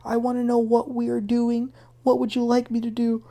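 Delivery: high, plaintive imitation of crying; mock crying. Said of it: "And she's crying".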